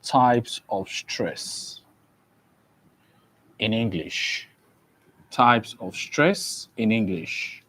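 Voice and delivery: slow, measured teacher dictating a title; slow dictation. Real, not acted. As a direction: spell it out phrase by phrase, with long pauses. Dictates the lesson title slowly.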